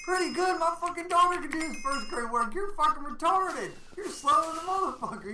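high-pitched jeering impression, laughing through it; mocking coworker. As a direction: squeak out the insult like a sneering coworker. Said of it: "the guy was like".

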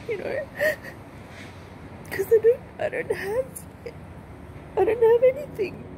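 high-pitched, sobbing words; crying. Real, not acted. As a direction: break down in real tears mid-sentence. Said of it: "I gotta stop crying".

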